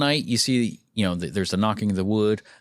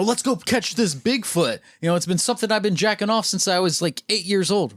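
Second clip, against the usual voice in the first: hyped Jim voice; 7 semitones higher than usual. Retold or imitated. Imitating character Jim.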